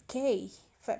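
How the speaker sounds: usual speaking voice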